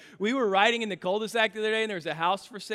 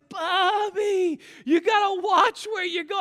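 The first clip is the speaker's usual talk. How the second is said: higher, louder dad calling out; mock scolding call; 12 semitones higher, 5 dB louder than usual. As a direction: call out a warning to the boy. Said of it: "and I was like".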